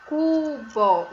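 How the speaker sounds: cubo drawn out as CU-BÓ; teacher recapping cubo in two syllables. Shown as a demonstration. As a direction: give cu and bó equal weight. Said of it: "segunda é o bó".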